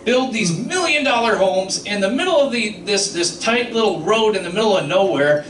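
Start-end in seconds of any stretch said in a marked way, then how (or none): none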